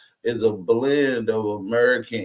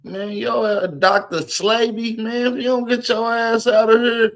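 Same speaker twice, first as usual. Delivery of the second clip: exasperated yell; 8 semitones higher than usual. Real, not acted. Yelling at the preacher on screen.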